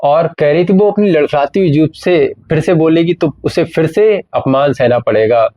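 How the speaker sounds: normal talking voice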